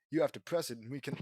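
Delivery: plain reading voice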